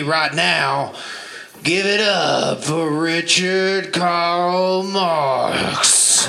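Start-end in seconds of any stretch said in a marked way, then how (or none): 1.7-6.2 drawn-out announcer's build to the name; announcer introduction. Real, not acted. Host bringing the next gentleman on stage, drawn-out announcement. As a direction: stretch the name out like an MC.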